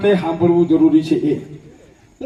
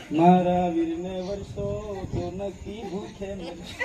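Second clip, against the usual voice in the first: mock lament; 3 semitones lower, 9 dB quieter, slower than usual. Imitates women's lament.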